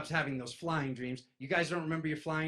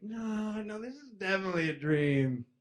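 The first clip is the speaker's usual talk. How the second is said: higher, knowing insistence; lightly higher self-assured no; insistent denial; 7 semitones higher than usual. Retold or imitated. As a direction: brush it off, pitched up. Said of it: "And I'm like".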